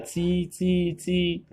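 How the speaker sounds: "ci" diucapkan jauh lebih tinggi, jelas dan berulang; pinyin ci. Shown as a demonstration. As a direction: contohkan "ci" dengan nada tinggi seperti guru. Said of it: "Suara badan lidah". ("ci" tinggi dan jelas, diulang untuk murid)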